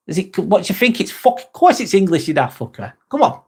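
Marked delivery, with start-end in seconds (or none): none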